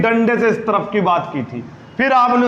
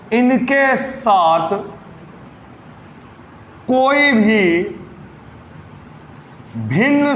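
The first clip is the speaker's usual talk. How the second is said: slow emphasis; much slower than usual. Shown as a demonstration. Teacher stressing rule slowly.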